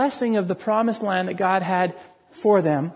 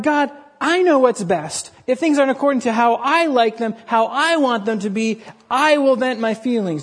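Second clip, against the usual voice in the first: mock-proud voice punching "I"; stress on I; 2 semitones higher, 3 dB louder than usual. Shown as a demonstration. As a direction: hit each "I" as the proud complainer. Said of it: "Did you catch the emphasis on I?".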